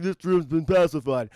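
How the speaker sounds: low-pitched tough-cop impression; Sylvester Stallone. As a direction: deliver it deep, like an action hero. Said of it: "I'll translate Stallone's".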